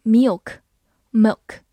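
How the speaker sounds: milk said wrongly as a mistaken vowel; wrong pronunciation. Shown as a demonstration. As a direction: demo the mispronounced milk. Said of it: "注意不要读成". (错误读音示范)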